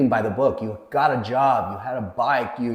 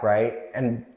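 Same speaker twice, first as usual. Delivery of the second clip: normal talking voice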